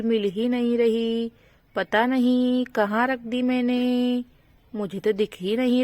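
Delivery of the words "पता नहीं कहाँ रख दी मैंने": usual speaking voice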